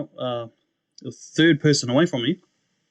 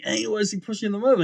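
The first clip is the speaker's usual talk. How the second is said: high, fast, baffled question; 9 semitones higher, faster than usual. Retold or imitated. Newcomer watching people fall at church, baffled. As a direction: rattle it off, confused by the scene.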